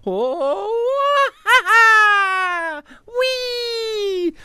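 high joyful flying shout; whooping cartoon jump; Mario voice. Shown as a demonstration. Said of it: "So I can go".

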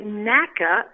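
Naka slowly, stress on NACK; first syllable stressed. Shown as a demonstration. Tour guide teaching the name's beginning, stressed first syllable. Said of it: "the emphasis".

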